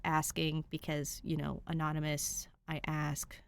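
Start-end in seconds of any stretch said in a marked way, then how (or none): none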